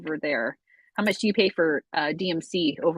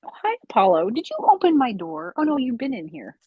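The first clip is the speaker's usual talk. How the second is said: bright, higher cooing to a pet; talking to a dog; 5 semitones higher, 3 dB louder than usual. Real, not acted. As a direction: greet the dog sweetly. Greeting the dog.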